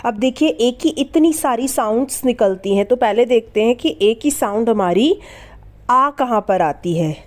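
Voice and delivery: ordinary delivery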